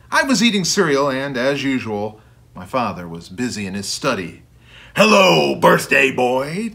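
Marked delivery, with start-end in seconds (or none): 5.0-6.7 big booming hello, drawn out; bellowing. A narrator's reading. Ted greets the boy at breakfast, bellowing. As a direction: bellow it like a big friendly monster. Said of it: "Ted bellowed".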